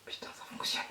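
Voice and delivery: very soft, unvoiced-sounding whisper to yourself; awed whisper. Real, not acted. Vlogger seeing the lash finally work, whispering. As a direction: whisper it as if afraid to jinx it. Hushed disbelief as it works.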